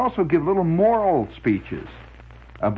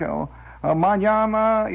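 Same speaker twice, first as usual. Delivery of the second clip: put-on pseudo Spanish accent; 8 semitones higher, slower than usual. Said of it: "a pseudo Spanish accent".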